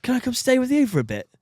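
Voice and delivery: mock-plaintive child; pleading nephew. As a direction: plead with your uncle for refuge. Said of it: "I'm gonna be the uncle".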